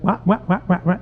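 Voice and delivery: muffled "wah-wah" adult noise; Charlie Brown parents. Acted. Imitating cartoon parents as the youngest born hears them, wordless wah-wahs. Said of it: "Remember Charlie Brown? The parents".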